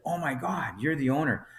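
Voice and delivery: retold awe as it dawns; amazed realisation. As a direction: imitate a stunned manager. Manager realises he is the owner.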